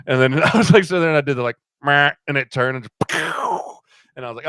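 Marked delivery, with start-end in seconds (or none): 1.8-2.1 loud, short mouth-made bleat; imitated deer bleat. Hunter shows how he stopped the doe, loud imitated bleat. 3.2-3.7 voiceless burst of mouth-made gunfire; imitated gunshot. Hunter acts out shooting the doe, voiceless gunshot imitation.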